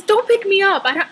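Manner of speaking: ordinary talk, nothing marked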